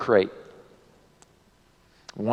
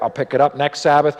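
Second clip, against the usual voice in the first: ordinary delivery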